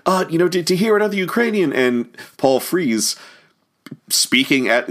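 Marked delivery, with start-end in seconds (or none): 0.1-1.7 high-pitched, gushing mock excitement; imitating thrilled fan. Ukrainian man meets Paul Frees backstage, imitated gushing delight. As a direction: play an overjoyed fan in a raised voice. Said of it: "came in and was like".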